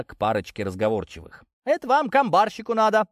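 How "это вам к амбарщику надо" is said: higher, louder helpful voice; willing, lively peasant reply; eager local man. A narrator's reading. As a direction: answer readily, pitched up. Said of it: "охотно отвечал Чернявый".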